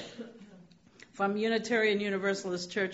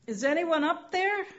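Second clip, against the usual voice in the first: yelled call; 10 semitones higher, 3 dB louder than usual. Retold or imitated. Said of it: "he yells out".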